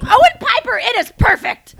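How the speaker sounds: playful over-the-top praise; mock gushing. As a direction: rave about it like a game-show host.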